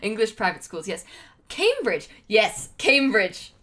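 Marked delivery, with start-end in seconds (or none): 1.5-3.5 relishing "Cambridge" in a posh British accent. Shown as a demonstration. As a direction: say it loud and posh, British style. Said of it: "well, posh to me".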